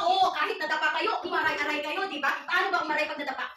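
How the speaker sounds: normal talking voice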